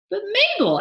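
very high, loud exclamation; excited cry. A narrator's reading. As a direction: cry out to the wife in amazement. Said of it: "Albert cried".

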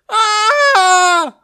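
long, very high scream; piercingly high, loud cartoon scream; Bugs Bunny. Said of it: "Bugs Bunny goes".

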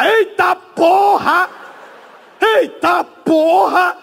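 long, high, drawn-out yell; hammed-up shout. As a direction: holler it slowly as if plunging down. Grito de quem desce, encenado. (grito exagerado, agudo e arrastado)

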